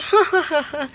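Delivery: mocking ha ha ha; wicked laugh; gloating laugh. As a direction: laugh at their pain. Villain laughing at accident.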